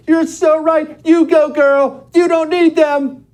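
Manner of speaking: very high, loud mock cheerleading; gushing supportive friends. Friends responding to an online rant, mock gushing. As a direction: squeal exaggerated support, drawing the words out.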